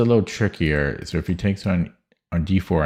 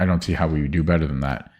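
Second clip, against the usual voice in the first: ordinary talk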